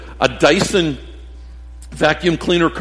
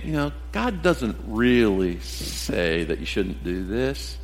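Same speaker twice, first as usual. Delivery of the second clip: soft, slow, coaxing hush; serpent's whisper; 5 semitones lower, 8 dB quieter, slower than usual. Retold or imitated. Satan whispering temptation into people's ears, soft and slow. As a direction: lean in and tempt them quietly. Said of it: "Satan's just whispering in their ear".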